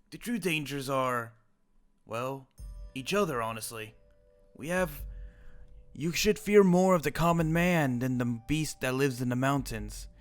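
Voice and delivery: ordinary talk, nothing marked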